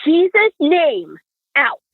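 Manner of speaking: high, drawn-out imitation of a command; sleeping mother's command. Daughter imitates her sleeping mother casting something out, mock commanding. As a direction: draw it out high, like casting someone out.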